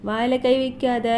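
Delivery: gently correct, without the sing-song; low gentle admonition. Real, not acted. Correcting baby's hand in mouth. (தாழ்ந்த குரலில் லேசாகக் கண்டித்தல்)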